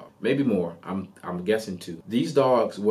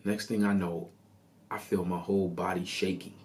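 ordinary talk

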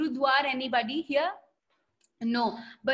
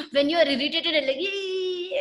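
crying, squealing mock fight noise; a kid's whiny shriek of irritation; irritated squeal; 3 semitones higher, 4 dB louder than usual. Teacher hams up a kid beating a sibling, whiny squeal.

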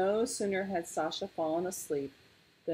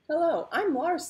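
high, louder greeting; bright-eyed cub hello, pitched high; friendly little bear; 12 semitones higher, 6 dB louder than usual. A narrator's reading. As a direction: introduce yourself as an eager young bear. Little polar bear greets the stranger.

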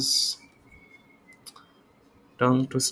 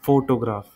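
Photograph with a strong opening FO; stress on first syllable; much faster than usual. Shown as a demonstration. Teacher contrasting three example words, stress on FO. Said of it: "जो फो है".

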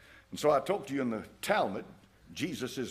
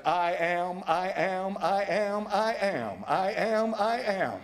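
building refrain; 6 semitones higher, slower than usual. Acted. Repeats Jesus' claim, building.

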